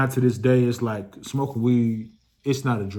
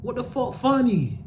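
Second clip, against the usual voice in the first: high, slow, baffled whine; 11 semitones higher than usual. Retold or imitated. [name] asking why the girl is laughing, high slow disbelief. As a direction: stretch it out high, lost for why it's funny. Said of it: "I'm like".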